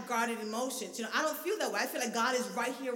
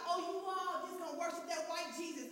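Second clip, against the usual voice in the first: mocking critic; 3 semitones higher, 10 dB quieter than usual. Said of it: "What we hear about".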